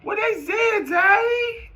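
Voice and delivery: high-pitched slow wailing "Daddy?"; mock high whiny shout. Young self yelling at his elder about devils, in a high wail. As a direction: wail like a scared child calling his dad. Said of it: "I was like".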